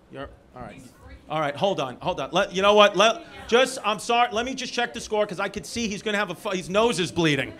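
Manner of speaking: ordinary delivery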